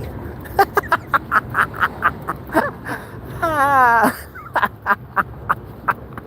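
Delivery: long, high burst of laughter; laughing. Real, not acted. Walker laughing at own joke, long high laugh. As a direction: crack up in staccato cackles.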